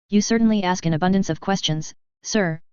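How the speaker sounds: plain reading voice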